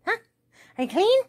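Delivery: high, loud pet talk; excited pet question. Real, not acted. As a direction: coo at the dog. Baby-talk to dog.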